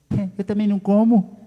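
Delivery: high, loud, offhand reply; doctor admitting she doesn't eat beans; mock doctor. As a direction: shrug it off like a careless doctor. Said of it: "Ela olhou pra mim e disse".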